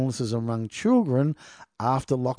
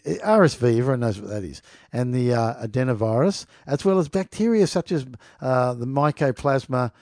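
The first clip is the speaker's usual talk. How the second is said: ordinary talk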